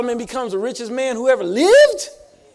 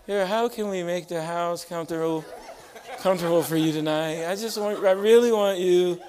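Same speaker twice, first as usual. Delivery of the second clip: imitating wife's airy voice; 3 semitones lower than usual. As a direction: playfully coo like an attentive wife. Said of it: "That eerie voice".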